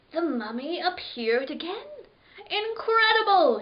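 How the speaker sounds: rising to a high, loud squeak on incredible. Acted. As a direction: squeak out the amazement. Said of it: "he squeaked".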